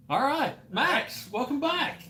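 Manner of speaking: high, slow on-air welcome; show host intro. Acted. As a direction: greet the listeners like a radio host.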